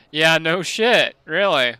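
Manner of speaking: loud, slow, sarcastic retort. Real, not acted. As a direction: bark it back with heavy sarcasm. Reacting to game voice line.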